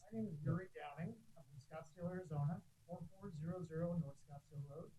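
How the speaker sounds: muffled through a face mask; speaking through a mask; full face dive mask. Real, not acted. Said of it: "Can you hear me through the mask".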